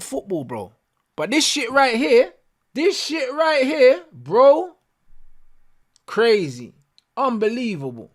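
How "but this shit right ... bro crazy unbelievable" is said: high, drawn-out disbelief; incredulous, fed-up emphasis; exasperated rant. Real, not acted. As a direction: stretch it out higher as exasperation builds. Exasperated at the team's display.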